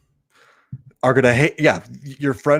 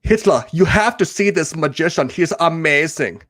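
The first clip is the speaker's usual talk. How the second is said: high, hammed-up German accent, building to a shout; mock German underling excitedly reporting, then commanding; 6 semitones higher than usual. Underling raving to Hitler about a magician, hammed-up German accent. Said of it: "your German accent".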